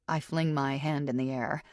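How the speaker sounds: ordinary delivery, nothing marked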